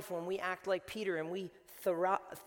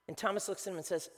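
ordinary talk, nothing marked

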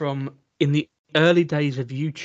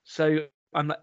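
ordinary delivery

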